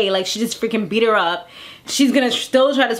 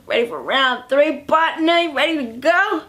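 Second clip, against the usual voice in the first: playful, rising come-on; hammed-up eager invitation; 3 semitones higher than usual. Same invitation repeated, hammed up.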